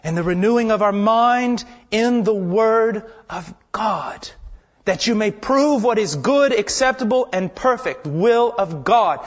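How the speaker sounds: full-force preaching. Acted. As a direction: preach it out to the whole church. Preaching to the congregation.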